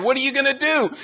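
ordinary delivery

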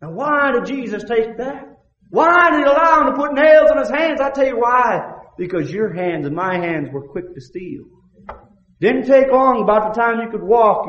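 high, loud, fervent preacher's cry; full-force preaching. Acted. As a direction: preach it out at the top of your voice.

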